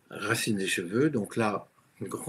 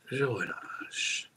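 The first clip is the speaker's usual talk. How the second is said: slow release; 4 dB quieter, much slower than usual. Shown as a demonstration.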